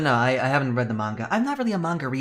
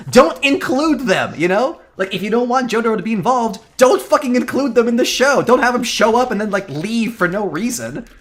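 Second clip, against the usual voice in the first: loud, higher, punching "don't" each time; angry rant; 9 semitones higher, 7 dB louder than usual. Real, not acted. Fan scolding the show's writers over Jotaro, loud and angry. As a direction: jab every "don't" like an order to the writers. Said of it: "This is my own personal rant".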